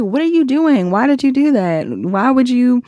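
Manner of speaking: mock-upset partner firing off questions; upset whining. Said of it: "somebody that's whining because they are upset".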